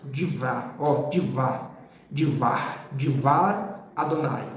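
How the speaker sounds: Divar repeated slowly and low; teacher drills Divar for students; correct pronunciation. Shown as a demonstration. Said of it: "pra se pronunciar a gramática muda".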